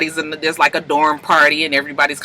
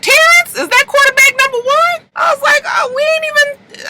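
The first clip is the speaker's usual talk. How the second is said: high, loud, stunned yell; realizing the quarterback is her secret lover, shrieking; shocked shriek; 4 dB louder than usual. Real, not acted. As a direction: scream the discovery at the screen. Own shocked reaction while watching.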